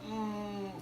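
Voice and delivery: tight little mm through a full mouth; muffled high mm. Retold or imitated. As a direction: squeak a closed-mouth mm. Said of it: "And I'm like".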